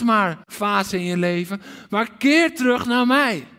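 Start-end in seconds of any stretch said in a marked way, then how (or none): 1.9-3.5 heart cry. Acted. Preacher voicing God, calling his people back loudly. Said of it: "De roep van Gods hart".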